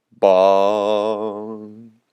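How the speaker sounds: long hollow bong; imitated bell toll. Storyteller voicing the bell tolling in the sky, drawn-out bong. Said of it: "A bell tolled in the sky".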